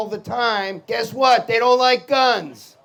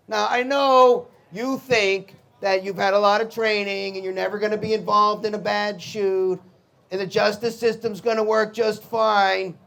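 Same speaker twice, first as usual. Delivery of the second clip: normal talking voice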